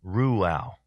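Ruel said as "ROO-al"; corrected pronunciation. Shown as a demonstration. Host correcting himself after hearing the app, Ruel pronounced "ROO-al". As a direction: say Ruel with a "roo" start, then a short "al".

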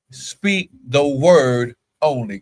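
"Speak the word only" drawn out slowly, word by word; slow, weighty stress on each word of "Speak the word only"; emphatic scripture quote. Real, not acted. Preacher emphasizing a Bible quotation.

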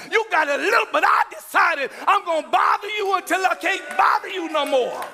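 fiery sermon shout; preaching. Real, not acted. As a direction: preach it out full force. Preaching at full force.

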